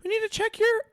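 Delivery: high, quick granny voice; granny pushing her grandson; foul mouthed granny. Acted. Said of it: "my grandma voice is not good".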